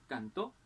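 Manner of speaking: cantó said can-TÓ; stress on last syllable. Shown as a demonstration. Teacher contrasting word stress, cantó stressed on TÓ. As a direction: land cantó on its final syllable. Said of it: "el acento puede variar".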